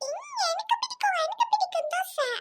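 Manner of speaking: put-on small girl gushing to her mother about dosa in a high voice; excited child voice. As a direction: squeal like a little kid who loves dosa.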